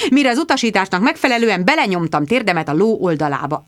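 usual speaking voice

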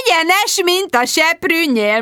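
high, drawn-out pronouncement; emphatic agreement. A narrator's reading. Sharon backing up Jude to console Tom, emphatic.

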